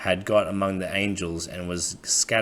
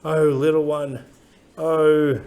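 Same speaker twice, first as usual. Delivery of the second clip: put-on old man's voice; 8 semitones higher, 4 dB louder, slower than usual. Old man voicing tender pity.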